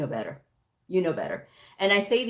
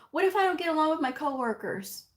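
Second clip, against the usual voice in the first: mock worry; 7 semitones higher, faster than usual. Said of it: "the whole time she's saying".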